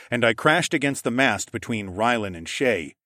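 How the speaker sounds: usual speaking voice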